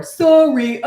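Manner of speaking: loud, high mock "sorry"; insincere apology. Mimicking someone excused by one word, loud insincere apology. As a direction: toss out an empty, exaggerated sorry.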